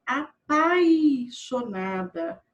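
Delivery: "apaixonada" with a clear, drawn-out I in "pai"; full "ai" sound. Shown as a demonstration. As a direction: stretch the "pai" of "apaixonada" so the I is heard. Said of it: "tá faltando o i". ("apaixonada" com o I de "pai" bem marcado)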